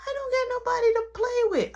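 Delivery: put-on high, loud childish complaint; imitating a lonely kid's whine; put-on child voice, pleading; whiny little boy. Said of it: "Stop crying".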